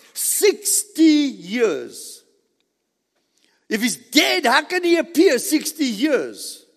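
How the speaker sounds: loud, high, drawn-out preaching; emphatic proclamation. Real, not acted. Preacher declares the resurrection to the congregation, emphatic proclamation. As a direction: proclaim it at full force, word by word.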